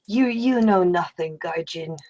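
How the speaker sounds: low, soft in-character rebuke; cold dismissal, dropping to a murmur; quiet contempt. Acted. Acted bitter rebuke to her master.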